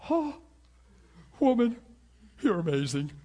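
high, delighted exclamation as Jesus; excited amazement. Acted. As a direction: marvel with delight, starting high and easing down. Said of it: "It really excited Jesus".

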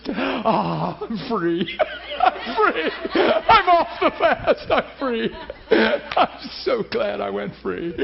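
loud, giddy cries through laughter; laughing with relief. Retold or imitated. Preacher reliving breaking his fast, laughing with relief. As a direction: whoop it out, laughing, drawing words out.

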